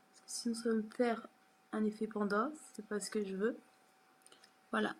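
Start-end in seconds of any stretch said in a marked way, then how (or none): none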